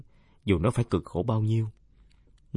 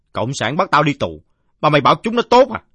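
loud outburst climbing high and quick; aggrieved drunkard; 7 semitones higher, 11 dB louder than usual. Acted. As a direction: fire back indignantly, pitch shooting up. (giọng ông say uất ức, vọt cao và to)

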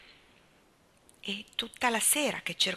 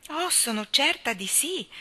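higher and slow, gently insisting; Anna reassuring Leslie she will like her, eager and higher; eager reassurance; 7 semitones higher, 4 dB louder than usual. A narrator's reading. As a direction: assure her warmly, drawing it out. Anna reassures new friend eagerly.

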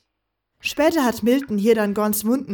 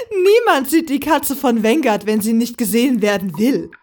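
amused, louder, laughter still in the voice; laughing; 5 dB louder than usual. Acted. Woman scoffs at the idea of being seen, laughing. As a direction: say it coming out of a laugh. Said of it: "Velaya lachte".

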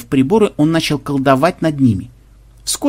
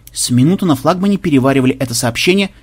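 usual speaking voice

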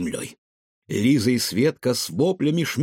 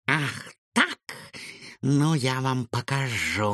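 menacing hiss; 2 semitones higher, 4 dB quieter, slower than usual. A narrator's reading. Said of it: "грозно прошипела Матильда".

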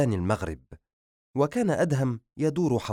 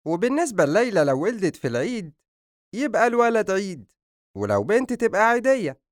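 raised, animated joker's voice; joking Hasan character; 10 semitones higher, 4 dB louder than usual. Acted. Hasan joking about baby names at the table, high animated voice. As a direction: pitch it up and crack the joke.

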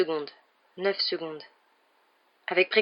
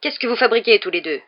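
raised, louder reprimand; yelling guard; 3 semitones higher, 7 dB louder than usual. A narrator's reading. Guard rushing at two boys, yelling. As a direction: call out an indignant scolding. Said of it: "un vigile se précipita en hurlant".

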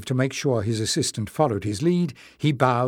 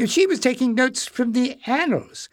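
put-on much higher, eager old man; old librarian Joseph; 12 semitones higher than usual. Old librarian confirms to the king, high and eager. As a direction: chirp it out eagerly.

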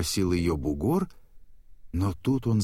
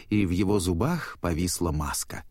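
plain reading voice

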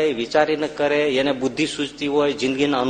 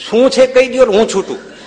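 impatient demand; 8 semitones higher, 8 dB louder than usual. Retold or imitated.

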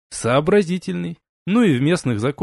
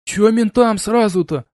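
higher, louder gopnik whine; russian street thug; 7 semitones higher, 4 dB louder than usual. A narrator's reading. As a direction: whine like a cornered street punk. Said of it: "подал голос гопник". (гопник, испуганно)

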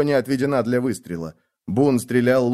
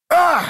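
piercing scream of pain, much higher and louder; death cry; 6 dB louder than usual. Acted. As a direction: scream as the arrow hits. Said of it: "Крик оборвался".

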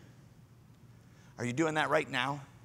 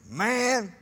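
Peter's flustered denial; 3 semitones higher, 3 dB louder, slower than usual. Retold or imitated. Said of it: "What an interesting way to phrase it".

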